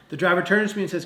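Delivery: usual speaking voice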